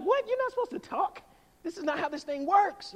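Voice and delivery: high-pitched mock disbelief; shocked churchgoer. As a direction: protest like a scandalized visitor, voice jumping up. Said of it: "that shocks some people".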